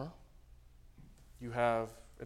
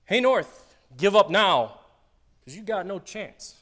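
high, loud mock command; God commanding the north; 6 semitones higher, 9 dB louder, slower than usual. Pastor voicing God ordering the north. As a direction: boss the north wind around. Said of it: "What kind of being commands the north".

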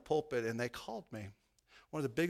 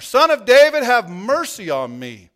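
very loud, higher beggar's cry, slowing on mercy; crying out all the more; 8 semitones higher, 18 dB louder than usual. A narrator's reading. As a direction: shout it louder still over the crowd. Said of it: "he kept crying out all the more".

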